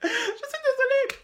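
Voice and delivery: high, slow, through giggles; laughing. Real, not acted. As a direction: apologise while cracking up.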